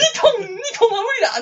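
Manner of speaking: laughing mock protest. Villager protests impossible tribute.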